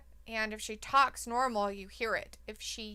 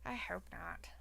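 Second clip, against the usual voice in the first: breathy whisper, almost no voice; whispered hope; 4 semitones lower, 14 dB quieter than usual. Real, not acted. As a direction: murmur it under your breath, dreading it. Dreading a wrestling booking outcome.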